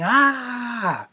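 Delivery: high, drawn-out mock scream at talking volume. Reviewer mimics the heroine screaming at her attacker, mock scream. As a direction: imitate a shriek without full force. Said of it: "And she's screaming".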